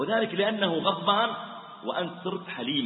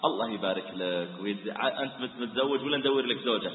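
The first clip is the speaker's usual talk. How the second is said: lower, softer, gentle and kindly; soft friendly greeting; 8 semitones lower, 5 dB quieter than usual. Shown as a demonstration. Preacher showing how to greet an angry man, soft and kindly. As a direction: win him over with softness. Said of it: "فلو جيت قلت".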